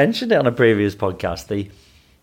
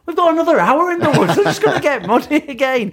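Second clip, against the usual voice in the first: half-exasperated, half-laughing protest; laughing exasperation. Retold or imitated. Teacher telling lad his wellies will get muddy, laughing.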